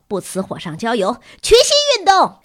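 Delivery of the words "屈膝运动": very high, loud squeal; shrill shout. A narrator's reading. Pupil yelling for knee bends, shrill. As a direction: shriek out a request. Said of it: "微博尖着嗓子".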